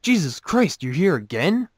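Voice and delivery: high-pitched, incredulous outburst; exasperated exclamation. Acted. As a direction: exclaim it in disbelief at a returning drunk. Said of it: "the bartender takes one look at the drunk and exclaims".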